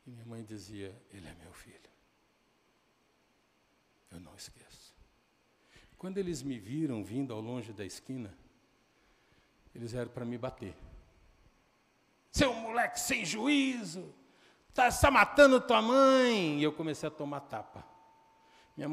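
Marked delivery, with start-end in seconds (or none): 1.1-5.0 very soft, low reply; quiet resolve. Retold or imitated. Mother answering neighbors who say to forget her son, hushed resolve. 12.3-16.6 loud, high, drawn-out yelling; scolding shout. Retold or imitated. Neighbors scolding the returning runaway as they hit him, angry shouting.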